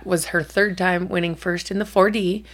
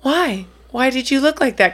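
put-on higher, puzzled kid question; imitating daughter; 5 semitones higher than usual. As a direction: ask it up high like a curious kid. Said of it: "And she goes".

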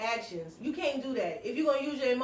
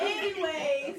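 slow, drawn-out anyways through a laugh; getting back on track laughing; 4 semitones higher, much slower than usual. Real, not acted. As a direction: stretch it out while giggling. Laughing, restarting story.

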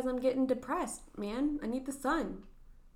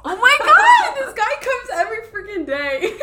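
loud laughing outburst; laughing shout; 12 semitones higher, 20 dB louder than usual. Real, not acted. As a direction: shriek then laugh it out. Startled shout then laughing.

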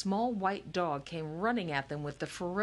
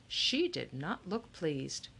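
ordinary delivery, nothing marked

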